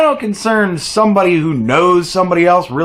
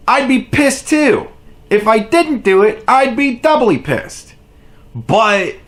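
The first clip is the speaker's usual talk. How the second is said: rising higher, incredulous rant; building exasperation; 6 semitones higher than usual. Real, not acted. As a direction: push up higher with each line. Host mocks, voice rising.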